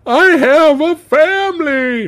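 high-pitched, drawn-out begging; pleading prison guard. Acted. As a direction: beg like a terrified victim, hammed up. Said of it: "And the guy goes".

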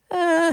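very high, unsure hesitation noise; hesitant uh. Retold or imitated. Studio assistant stalling when asked about song, high unsure uh. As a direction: squeak out a doubtful uh. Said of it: "And he goes".

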